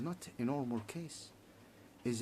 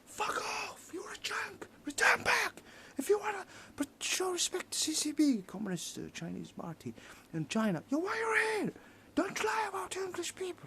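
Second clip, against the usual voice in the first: high-pitched angry shouting imitation; mock British people; 3 dB louder than usual. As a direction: yell it in a strained, very high mocking voice. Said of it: "you would start saying, British people".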